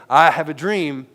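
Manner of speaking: loud, slow orator's declaration; Martin Luther King. Retold or imitated. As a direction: proclaim it slowly to a crowd. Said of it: "the moment that I say".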